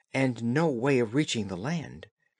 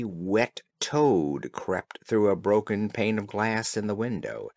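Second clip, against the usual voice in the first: ordinary delivery, nothing marked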